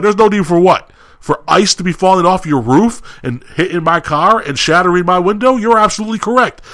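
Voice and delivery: high, heated sarcastic comeback, retold; indignant retort. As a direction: throw his words back at him angrily. Throws manager's words back in anger.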